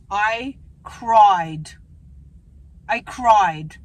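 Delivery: CRIED hit loud and drawn out; emphatic stress. Real, not acted. Emphatic retelling of painful blood test.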